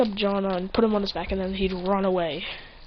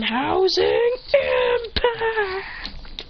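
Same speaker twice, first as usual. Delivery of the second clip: high, strained, stretched-out battle yell; hammed-up Bakugo-style attack shout; anime attack cry; 12 semitones higher, much slower than usual. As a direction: scream the move name in slow motion. Attack name called again.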